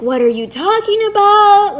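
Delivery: high-pitched imitation of protesting innocence; mock indignant denial. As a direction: imitate him protesting, voice shooting up. Retold partner's indignant denial.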